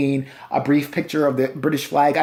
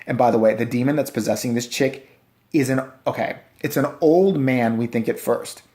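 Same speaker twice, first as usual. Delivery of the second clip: ordinary delivery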